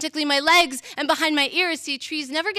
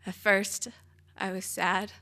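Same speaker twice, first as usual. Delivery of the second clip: ordinary talk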